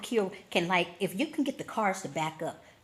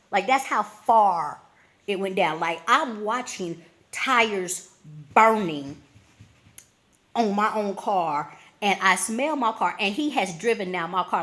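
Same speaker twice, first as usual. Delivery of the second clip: louder, drawn-out outrage; outraged; 2 semitones higher, 6 dB louder, slower than usual. Real, not acted. Describing the stranger burning her tires, loud slow outrage. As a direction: stretch out the disbelief loudly.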